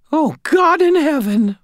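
high, slow cry of dismay; shocked landlady. A narrator's reading. Landlady kneeling by fallen tenant, high dismayed voice. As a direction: gasp it out as a horrified landlady.

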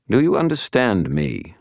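high, condescending captor; taunting villain. A narrator's reading. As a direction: press the prisoner for an answer, pitched high. Captor taunts his prisoner.

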